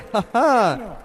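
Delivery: short laugh after a joyful line. Real, not acted. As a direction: laugh out briefly. Preacher laughs after rejoicing verse.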